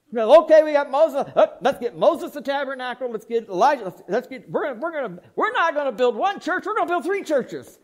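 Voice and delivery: high, loud, rushing with giddy laughter; an overeager disciple laughing as he plans three tabernacles; excited Peter. Retold or imitated. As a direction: act out Peter tripping over his own enthusiasm. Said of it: "As he got excited".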